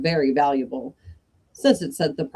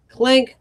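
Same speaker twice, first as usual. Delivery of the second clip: clank noise; 7 semitones higher, 7 dB louder than usual. Retold or imitated. Imitating ghost's chains.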